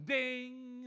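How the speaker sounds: high, held chime; imitated email ding. Sudden email from the boss, imitated ding. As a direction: sing out a bright notification sound.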